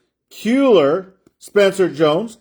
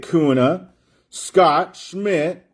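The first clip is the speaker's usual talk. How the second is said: ordinary talk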